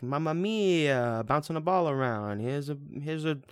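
drawn-out singsong Italian caricature; mock Italian accent. Reviewer mimicking the film's Italian dialogue, mock Italian accent. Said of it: "switched back from English to Italian".

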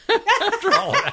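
real giggle at her own letdown; laughing. Real, not acted. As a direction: laugh the words out in disbelief.

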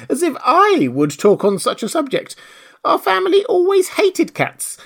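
high, loud, squeaky outrage; indignant mouse. A narrator's reading. The trembling mouse rebukes Alice, high and indignant. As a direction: protest as a small, offended mouse. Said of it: "cried the mouse, who was trembling".

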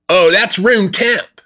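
high, drawn-out know-it-all announcement. Acted. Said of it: "be the guy that was knowing it all".

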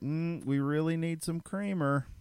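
slow, drawn-out hinting voice; lightly hammed-up wife dropping hints; imitating wife's hint. Wife hinting on the phone about creamer, slow mock hint. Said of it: "call and be like".

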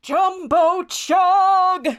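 high, very drawn-out team name; big slow arena introduction; announcer yell. Acted. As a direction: stretch the name out for the crowd. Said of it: "the M C yells once again".

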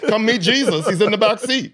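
high, flamboyant evangelist call. Retold or imitated. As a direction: beckon like a showman preacher, way up high. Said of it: "It was very [name]".

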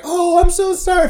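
high, mock-sweet apology; mock apologetic clerk. Mimicking the front desk lady, high fake apology. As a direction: squeak it like a flustered clerk. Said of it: "she's like".

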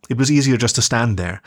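plain reading voice

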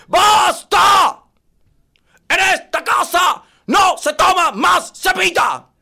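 slow, high, loud furious shout; furious dad. Acted. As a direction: lay down the law at the top of your lungs. Papá corta la pelea. (papá furioso gritando lento)

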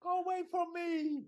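put-on high, hammy Italian accent. Super criminal to the arresting officer, hammy Italian accent. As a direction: fend them off in a mock Italian accent. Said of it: "I mean, the accent is something else".

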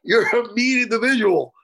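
high, wounded complaint; tearful laughing protest. Real, not acted. To the co-host who keeps picking on him, tearful protest. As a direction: protest high, half crying and half amused. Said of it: "Now I'm crying".

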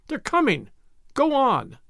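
high, urgent call; crowd urging. A narrator's reading. People thrusting at the man, high urging call. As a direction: urge him on, pitched high. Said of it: "said the people, thrusting at him".

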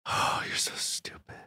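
barely voiced, hushed teasing insult; whispered jab. Real, not acted. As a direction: whisper it at your co-host. Hushed jab at co-host's mistake.

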